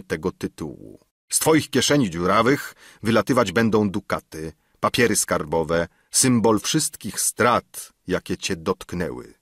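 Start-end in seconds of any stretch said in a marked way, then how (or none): none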